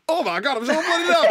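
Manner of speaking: very high, loud caricature of a northerner; mocking accent impression. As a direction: imitate a bad mimic overdoing someone's accent. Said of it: "does an absolutely brilliant, uh, impression of you".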